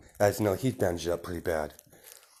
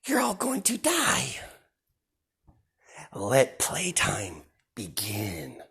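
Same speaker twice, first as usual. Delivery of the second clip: sinister whisper; 3 semitones higher, slower than usual. Acted. Deku promising the villains they will die, in a creepy whisper. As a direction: whisper their doom with relish.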